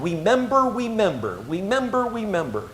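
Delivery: "Remember" said as "wemember", softly sing-song; toddler's W for R. Retold or imitated. Three-year-old dancing round the floor, "remember" lisped as "wemember". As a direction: chant "remember" softly, starting it with a W. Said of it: "he can't say his R's".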